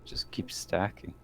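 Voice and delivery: breathy whisper under the breath; whispered aside. Real, not acted. Player whispering a joke aside about stacking bonuses.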